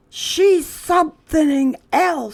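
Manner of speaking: put-on high, slow boy voice; a boy muttering about the new girl, high; boy's voice. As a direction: marvel at her in a high kid voice. Boy remarking on Lily.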